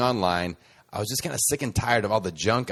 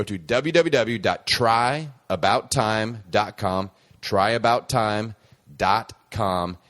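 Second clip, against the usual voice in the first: louder, slow dictation of "try about time dot com"; slow spelled-out address; 5 dB louder, slower than usual. Shown as a demonstration. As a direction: read the address slowly and clearly. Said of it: "spelling out the web address".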